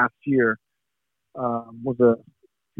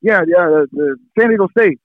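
higher, excited call across a room; mock yell back; 8 semitones higher, 4 dB louder than usual. Scout yelling the name back to the war room, mock shout. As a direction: bellow the name back like on a noisy phone. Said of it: "So he screams it back".

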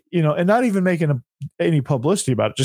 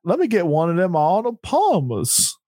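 a Yankee faking a Southern twang; mock Southern accent; 4 semitones higher than usual. Co-host parodying the coach's put-on Southern voice. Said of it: "Like that".

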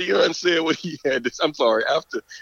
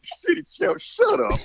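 retold shutdown; slower than usual. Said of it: "I said".

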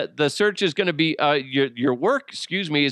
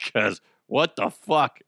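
ordinary delivery

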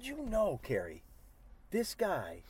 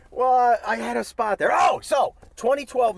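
loud restart; 7 semitones higher, 10 dB louder than usual. Real, not acted.

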